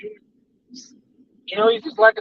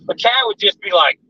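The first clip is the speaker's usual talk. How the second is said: usual speaking voice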